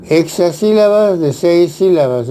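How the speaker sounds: normal talking voice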